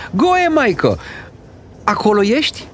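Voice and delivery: high-pitched call through a door; anxious calling. Acted. Grandmother calling to the boy behind a door, anxious calling. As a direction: call out high to someone shut inside.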